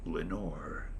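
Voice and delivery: soft, low echo of the name; a faint echo murmuring the name; murmured echo. A narrator's reading. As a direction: let it fade back like an echo. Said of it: "an echo murmured back the word".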